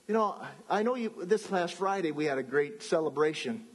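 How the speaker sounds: ordinary delivery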